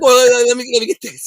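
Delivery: high-pitched eager stammer; eager grabbing. Retold or imitated. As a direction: blurt it out, re-enacting sudden eagerness. Eagerly grabbing the offered joint.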